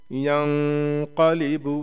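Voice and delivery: यंक़लिबु with a long nasal hum before क़; nasal ghunna. Shown as a demonstration. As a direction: hold the nasal of यंक़लिबु, then release into क़. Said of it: "same उसी हिसाब से".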